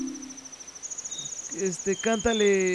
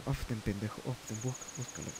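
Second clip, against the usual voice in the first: muttering to himself; 11 semitones lower, 15 dB quieter, faster than usual. Real, not acted. Muttering while searching translation.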